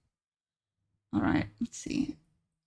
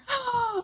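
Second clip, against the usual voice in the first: high gasp; 13 semitones higher than usual. Real, not acted. Reacting to cute hamster.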